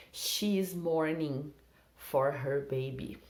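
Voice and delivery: slow, careful sentence for learners; slow example. Shown as a demonstration. Teacher reading an example sentence slowly. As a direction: stretch each word out clearly. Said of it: "model sentence slowly".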